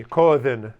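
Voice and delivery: Ko'odhin said clearly, stress on the first syllable, falling at the end; slow, clear model of Ko'odhin for the class to copy; model pronunciation. Shown as a demonstration. Teacher leading a class drill, clear model pronunciation. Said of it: "Everyone repeat after me".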